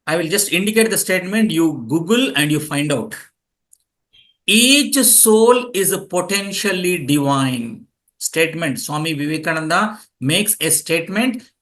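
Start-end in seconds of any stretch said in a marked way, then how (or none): 4.5-7.9 slow, deliberate quote to memorize; slow dictation. Shown as a demonstration. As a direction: give it slowly so they can learn it by heart. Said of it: "You all should memorize this statement".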